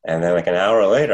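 ordinary delivery

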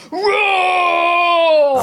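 high, loud mock dinosaur roar; toddler T-Rex roar. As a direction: roar like a three-year-old playing T-Rex. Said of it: "chaotic three-year-old going".

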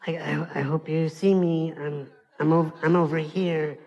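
soft, low, halting timid voice; small, hesitant plea for attention; meek, helpless man. Acted. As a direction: stammer it, hoping to be noticed. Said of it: "I took on this physiology of".

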